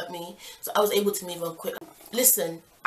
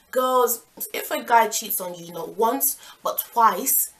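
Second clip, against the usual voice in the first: ordinary delivery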